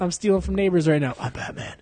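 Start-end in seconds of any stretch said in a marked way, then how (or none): 1.2-1.7 soft, whispered superhero line; hushed, understated Batman whisper; nineties Batman. Acted. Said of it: "nineties Batmans who are like".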